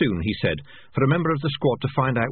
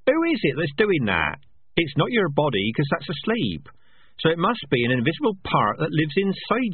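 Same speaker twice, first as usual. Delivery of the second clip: higher, earnest rat voice; a small rat puzzling out the soul aloud; curious rat; 7 semitones higher than usual. Acted. Rat muses about dreams.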